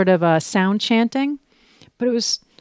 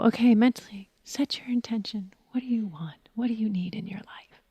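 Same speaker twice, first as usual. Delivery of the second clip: hushed whisper, much softer; soothing whisper; 3 semitones higher, 8 dB quieter than usual. Retold or imitated. Teacher whispering intention prompts, soft and soothing. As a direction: whisper the questions gently like a meditation guide. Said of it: "she has this voice that's so soothing".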